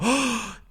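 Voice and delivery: sharp mock-offended gasp; theatrical intake of breath; shocked gasp. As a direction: gasp as if insulted. Acted shock at teacher's insult.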